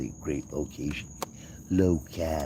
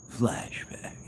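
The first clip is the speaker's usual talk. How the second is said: whispered flashback; 3 semitones higher, 4 dB quieter than usual. Pretend. Flashback bit again.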